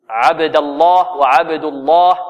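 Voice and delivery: slow "عبد الله" with a thick double ل; heavy lam. Shown as a demonstration. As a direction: say "الله" with full dark lam after a and u. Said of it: "واللام تفخم أو تغلظ في لفظ الجلالة". (تفخيم لام «الله» في «عبد الله»)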